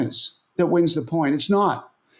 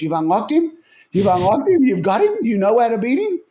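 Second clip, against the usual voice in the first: incredulous; 4 semitones higher than usual. Retold or imitated. Marion hearing his analyst has cracked Rafa, rising disbelief. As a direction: repeat it, more stunned each time. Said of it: "He goes".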